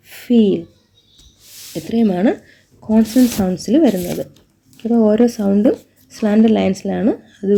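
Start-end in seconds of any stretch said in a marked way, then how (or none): none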